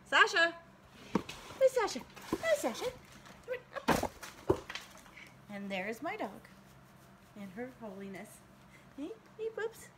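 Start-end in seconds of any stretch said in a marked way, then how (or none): 0.1-4.1 high, loud call to the dog by name. Real, not acted. Vlogger calling her dog Sasha over, high loud call. 9.0-9.9 cooing at the dog. Real, not acted.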